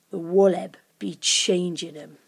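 low creature voice; wicked creature, relishing the threat; menacing creature. A narrator's reading. Creature frightens Lucy about the Wallab, menacingly.

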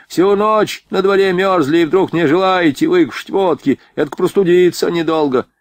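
affronted soldier objecting; indignant protest. A narrator's reading. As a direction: protest in disbelief, voice lifted. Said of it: "возмутился солдат".

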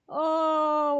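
ordinary delivery

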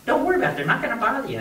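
high-pitched acted coaxing; retold exasperated reassurance. Cowhand to the scared kid, exasperated reassurance. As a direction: pitch up, telling a scared kid it's fine.

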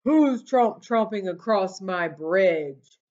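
loud, slow troll roar. Acted. Troll roaring at the goat crossing his bridge, loud and slow. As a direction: boom it out like a troll under the bridge. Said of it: "A roar rose up from below".

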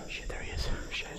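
hushed, breathless whispering; frightened whisper. Real, not acted. Hiding in a corner from an attacker, frightened whisper. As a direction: whisper so he won't find you.

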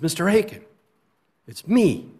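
high, loud eager call of a grown former student; excited greeting. Retold or imitated. Teacher retelling a former student at his door, eager and loud. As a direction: call out his name like he's bursting to be recognised.

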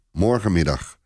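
plain reading voice

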